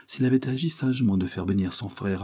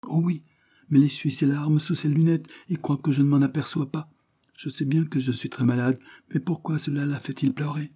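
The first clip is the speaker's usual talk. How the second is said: put-on higher, gentle child voice; sick little boy; 7 semitones higher than usual. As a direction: play the frail young boy. Narrator voices William.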